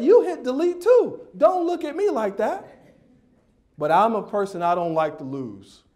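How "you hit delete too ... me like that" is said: high, indignant mock protest; comic touchy outburst; defensive comeback. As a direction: fire back at judging parents, pitched way up. Snaps back at staring parents.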